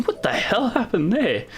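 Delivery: high, slow, disbelieving; baffled exclamation. Retold or imitated. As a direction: react in confused disbelief. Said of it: "I remember looking at it, going".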